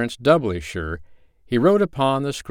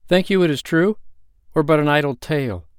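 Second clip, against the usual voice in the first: higher, louder worried question; doubtful traveler; 7 semitones higher, 4 dB louder than usual. Acted. One friend asks the other, worried. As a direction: ask with worry. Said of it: "the one said to the other".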